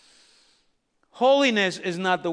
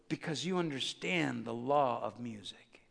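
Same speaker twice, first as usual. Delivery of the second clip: hushed whisper; 9 semitones lower, 12 dB quieter than usual. Real, not acted. Quiet aside about music's law.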